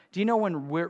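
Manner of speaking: ordinary talk, nothing marked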